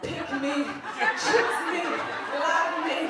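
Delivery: loud, high begging plea; pleading Meredith. Retold or imitated. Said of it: "when she's like".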